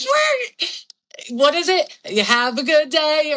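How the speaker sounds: high, sing-song tipsy cheer; drunk cheerful greeting. Retold or imitated. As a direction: chirp it like a wasted optimist.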